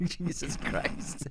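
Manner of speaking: whispered. Real, not acted. Whispered exasperation.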